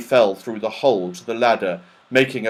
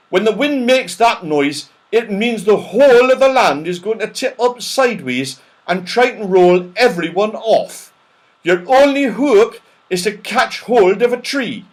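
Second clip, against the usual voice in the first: urgent warning; 9 semitones higher, 6 dB louder than usual. Acted. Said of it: "shouted the people round about".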